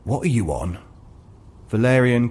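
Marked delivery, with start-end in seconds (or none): 0.0-0.8 low, soft, suspicious grumble; gruff shopkeeper. Acted. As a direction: eye him with suspicion.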